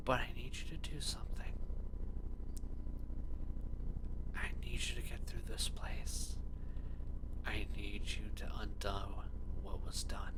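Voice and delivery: tender whispered mother's voice; a loving mother, breathed like a ghost; whispering ghost mother. Acted. Mother's ghost speaks to hero.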